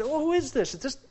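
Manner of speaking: high, wondering voice of the crowd; high, lightly voiced astonished townsfolk; mock astonished crowd. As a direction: play the amazed townsfolk. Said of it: "and they said".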